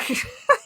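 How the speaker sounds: high short laugh; quick high laugh; burst of laughter. Real, not acted. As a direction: laugh it off knowingly. Laughs at predicting elimination.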